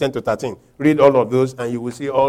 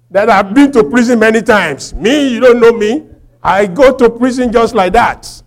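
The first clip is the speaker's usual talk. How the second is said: raised, higher-pitched swagger; mock boasting; 9 semitones higher, 8 dB louder than usual. Preacher imitating someone boasting of prison, mock-proud. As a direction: brag as if prison were an honour.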